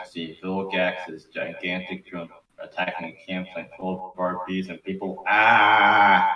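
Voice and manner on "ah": loud scream. Acted. Campers screaming.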